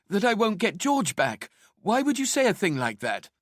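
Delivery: high-pitched, anguished protest; upset accusation. Acted. Wife's upset accusation in quarrel.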